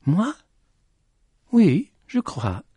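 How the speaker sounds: high voice, drawn-out answer; high called reply. Acted. The lady replies to Petit Jean's question, calling back high. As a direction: answer high, stretching the words.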